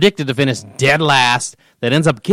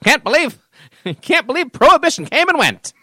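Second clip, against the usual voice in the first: put-on old-timey voice; 11 semitones higher than usual. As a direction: crack up while playing an old-timer. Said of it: "we're back in old-timey voice".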